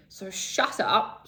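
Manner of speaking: ordinary talk, nothing marked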